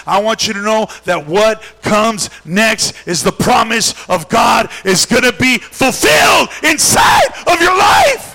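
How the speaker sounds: loud, high, hammering sermon cadence; full-force preaching. Acted. Pastor urging the congregation never to give up: loud impassioned preaching. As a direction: preach it out, rising to a roar.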